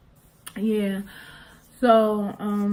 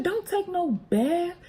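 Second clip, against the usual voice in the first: mom's slow firm order; 9 semitones higher, slower than usual. Retold or imitated. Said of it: "She said".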